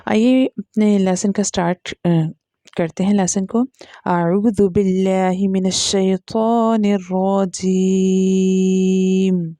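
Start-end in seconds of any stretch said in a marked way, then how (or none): 4.1-9.5 slow, drawn-out Quran recitation; Quranic recitation. Shown as a demonstration. Teacher opens the lesson with slow Quran recitation. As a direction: recite it slowly, each syllable stretched.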